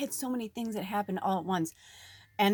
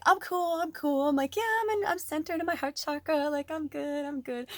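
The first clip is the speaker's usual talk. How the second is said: a lightly voiced fake-zen self, higher; mock calm; 8 semitones higher than usual. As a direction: pretend you are totally fine, airy and high. Mocking her own fake calm.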